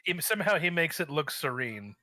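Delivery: ordinary talk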